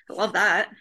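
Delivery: dry, sarcastic-sounding; sounds sarcastic. Real, not acted. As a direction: say it like you don't mean it. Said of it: "it sounded like sarcasm".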